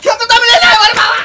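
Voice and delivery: loud, high tearful lament; panicked wailing. Acted. Husband discovering his quilt is gone, panicked wailing. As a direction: cry out as if all is lost.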